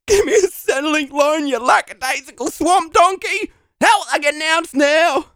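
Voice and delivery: high, worked-up shouting; tearful angry demand. Acted. Applicant demanding a loan from interviewer, tearful and angry. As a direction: demand it through tears, building to a yell.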